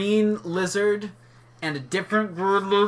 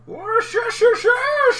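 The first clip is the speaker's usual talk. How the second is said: high laughing yell of Worcestershire sauce; laughing shout. Pretend. Shouted mock pronunciation, laughing.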